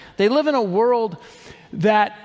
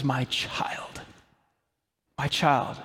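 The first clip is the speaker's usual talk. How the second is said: soft, lower, whispered tender call; tender father; 5 semitones lower, 6 dB quieter, slower than usual. Acted. God looking at the believer, softly whispering. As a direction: breathe it out like a loving father. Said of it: "he goes".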